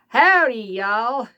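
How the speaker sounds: slow drawled Texan greeting; cowboy voice. Pretend. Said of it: "he's saying".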